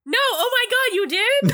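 high-pitched, astonished outburst; shocked disbelief. Real, not acted. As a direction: react as if fooled by the story. Shocked by co-host's joke reply.

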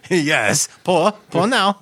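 high mock Russian accent. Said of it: "Russian accent talk".

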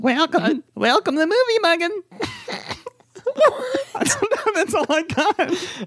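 very high squeaky voice, laughing; helium voice. Pretend. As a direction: squeak it out through giggles. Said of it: "You told me I sounded like what?".

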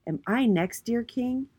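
small, hesitant storybook voice; shy. A narrator's reading. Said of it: "stepped forward and said shyly".

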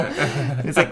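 ordinary talk

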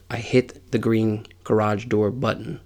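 usual speaking voice

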